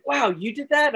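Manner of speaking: high-pitched impressed question; mock amazed. People reacting to his sequence, mock amazement. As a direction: imitate an awestruck viewer, pitch jumping up.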